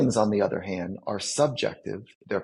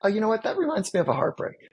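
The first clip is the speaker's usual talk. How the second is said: much higher, lightly acted wistful voice; imitating someone recalling a heartbreak, pitched way up; wistful realization; 8 semitones higher, faster than usual. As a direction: float it up high as the memory hits. Voicing a person noticing heartbreak.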